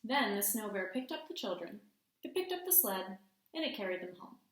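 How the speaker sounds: storytime read-aloud with hushed suspense; gentle picture-book narration; reading to children. A narrator's reading. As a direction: read it like bedtime story time for the class. Teacher reads story aloud.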